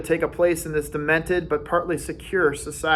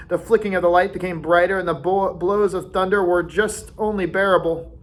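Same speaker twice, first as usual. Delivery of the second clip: plain reading voice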